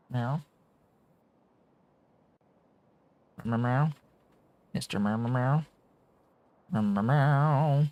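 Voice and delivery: high, stretched-out playful meows; cat meows. Pretend. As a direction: meow like a kitten to the cat. Talking to his cat Ollie.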